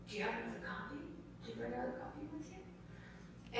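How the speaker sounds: retold stranger's hushed, careful question; gentle inquiry. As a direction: ask in a near whisper, lifting the pitch.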